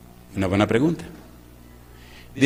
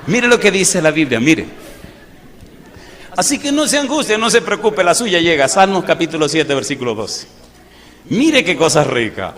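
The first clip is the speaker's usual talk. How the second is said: higher and much louder, thundering from the pulpit; fiery preaching; 4 semitones higher, 6 dB louder than usual. Acted. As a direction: blast it out at the congregation, half mocking. (predicación a todo pulmón)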